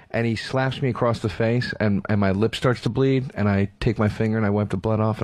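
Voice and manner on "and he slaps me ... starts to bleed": ordinary talk, nothing marked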